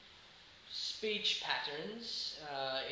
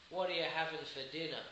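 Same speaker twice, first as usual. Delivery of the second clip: "What are you having for dinner?" fast, run together as "whatcha havin' fer dinner"; more naturally; faster than usual. Shown as a demonstration. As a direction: rush it out like a casual Aussie. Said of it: "More naturally".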